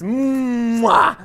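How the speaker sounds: big drawn-out smooch; long hum bursting into a playful kiss; kiss sound. Pretend. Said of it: "I could kiss you".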